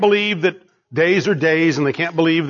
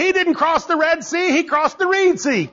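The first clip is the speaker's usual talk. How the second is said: higher, prissy mockery of a scholar; mock skeptic; 8 semitones higher than usual. Preacher mocking skeptics' Reed Sea claim, higher. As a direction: scoff at the correction. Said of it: "They say".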